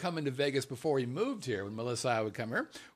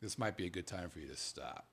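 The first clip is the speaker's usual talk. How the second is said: soft, lower, gentle dealer warning; a kind dealer quietly suggesting a break; quiet dealer advice; 6 semitones lower, 8 dB quieter than usual. Retold or imitated. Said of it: "dealers will look at you and go".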